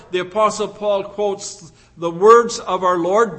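ordinary talk

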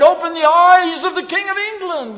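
high, loud martyr's cry; cried out. Retold or imitated. Preacher voicing Tyndale at the stake, crying out. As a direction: cry it out like a dying prayer. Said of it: "and cried".

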